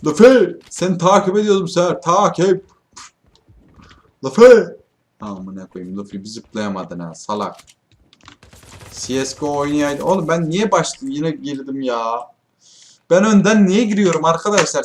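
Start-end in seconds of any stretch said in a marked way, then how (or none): 0.0-4.6 loud, high exasperated yell; shouting at teammate. Real, not acted. Gamer yelling at teammate Luffy he was following, exasperated and loud. 13.1-14.8 frustrated outburst. Real, not acted. Frustrated gamer complaining.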